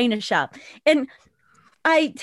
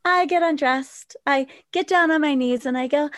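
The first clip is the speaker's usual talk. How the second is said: ordinary talk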